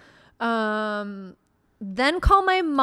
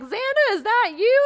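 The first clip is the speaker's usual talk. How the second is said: much higher, mock-startled "is that you"; surprised coworkers; 11 semitones higher than usual. Passersby recognizing her at the wreck, high and startled. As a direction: play passersby who spot her, voice jumped way up. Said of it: "they're like".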